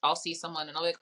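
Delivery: ordinary talk, nothing marked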